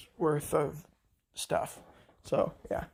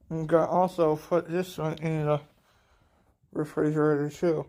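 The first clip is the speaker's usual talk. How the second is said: talking around a spoon in mouth; words muffled by a spoon. Real, not acted. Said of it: "I have a spoon in my mouth".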